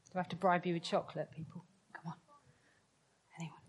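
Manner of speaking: usual speaking voice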